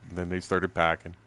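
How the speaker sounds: usual speaking voice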